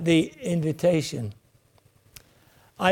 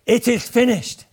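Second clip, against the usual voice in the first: triumphant cry; 4 semitones higher, 5 dB louder than usual. Retold or imitated. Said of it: "he cried triumphantly".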